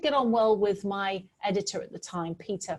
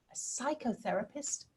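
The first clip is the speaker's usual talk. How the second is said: quiet surprise; 3 semitones higher, 9 dB quieter than usual. Retold or imitated. Her surprised reply.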